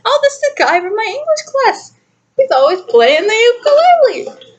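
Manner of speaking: high, excited recalled thought. Retold or imitated. Recalling spotting a classmate's profile, high and bright. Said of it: "I was like".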